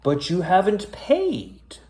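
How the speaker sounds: a hint of a moaning driver; mild moan. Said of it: "the driver moaned".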